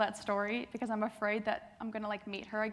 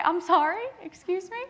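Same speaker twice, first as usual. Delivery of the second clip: puzzled reply; 7 semitones higher, 6 dB louder than usual. Retold or imitated. Retold confused reply.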